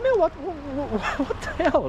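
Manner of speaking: high laughing what the hell; incredulous outburst. Retold or imitated. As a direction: throw up your hands, can't believe this guy. Exasperated at border officer.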